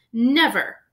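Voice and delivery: defiant shout. A narrator's reading. Harry defies Voldemort.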